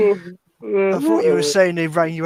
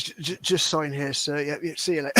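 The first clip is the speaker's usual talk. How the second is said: softly stammered, slowed-down mock courier; delivery driver; 3 semitones lower, 8 dB quieter than usual. Driver handing the wank suit to a customer, hesitant mock politeness. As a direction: imitate an awkward courier, quietly and haltingly. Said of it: "Fucking delivery driver turns up and goes".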